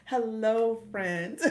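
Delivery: loud, bright greeting breaking into a laugh; a big laughing hello. Real, not acted. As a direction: call it out big, grinning. Echoing hubby's greeting, delighted.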